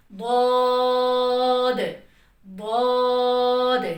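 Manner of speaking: द्वाद stretched long and full, loud, tongue edge on the molars; द्वाद drawn out slowly, heavy and loud; heavy drawn-out dwad. Shown as a demonstration. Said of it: "हल्की सी जुबान की नोक को दाल वाले मखरज पे touch करना".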